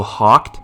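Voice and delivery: Hawked said to rhyme with "talked"; Hawked pronunciation. Shown as a demonstration. Gamer reads out a player's surname, saying Hawked with "aw". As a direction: say Hawked with an open "aw" vowel. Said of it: "Is that how you say his name".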